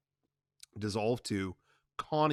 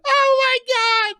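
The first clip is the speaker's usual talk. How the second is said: much higher, loud wailing; screaming and crying; 12 dB louder, slower than usual. Acted. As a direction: break down screaming. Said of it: "Connie is screaming and crying".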